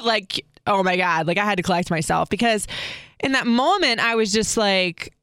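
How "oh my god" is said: usual speaking voice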